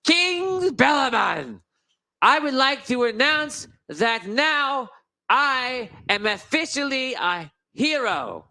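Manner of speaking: pompous proclamation. Acted. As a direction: proclaim it to the king like a herald. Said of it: "and he goes".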